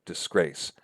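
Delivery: DISGRACE stressed on GRACE; light dis, then weight on GRACE in DISGRACE; iambic stress. Shown as a demonstration. Lecturer giving an example of the iamb, second syllable stressed. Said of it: "word that fits that pattern".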